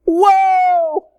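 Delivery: high, loud, drawn-out cry of alarm; startled yell. Retold or imitated. Two people startled by flying snake, yelling. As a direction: leap back from it with a big mock yell. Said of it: "And both of us".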